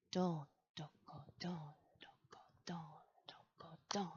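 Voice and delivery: whispered drum beats, a touch fuller; quiet drumming. A narrator's reading. Two squirrels drumming together, still whispered. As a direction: tap the beats out softly under your breath. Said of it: "Sound got a little louder".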